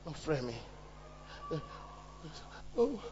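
ordinary talk, nothing marked

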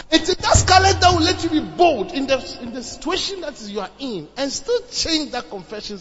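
loud, high, rousing sermon; full-force preaching. Acted. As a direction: exhort the congregation at full voice.

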